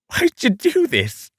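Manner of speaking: high-pitched Australian line read; Australian accent. Acted. Voice actor redoing a line to Maz in an Australian accent. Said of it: "using the accent again".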